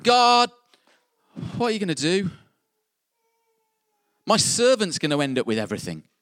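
high loud "God!" then exasperated; frustrated outcry. Pretend. Abram ranting at God over his heir, loud and exasperated. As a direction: vent the disappointment. Preacher voicing Abram's complaint.

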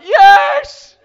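very high, full-force yell; screamed reply. Retold or imitated. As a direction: scream back as if on a runaway ride. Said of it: "just scream".

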